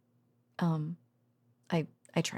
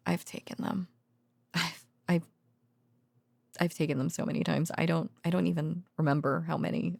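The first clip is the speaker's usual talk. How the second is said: normal talking voice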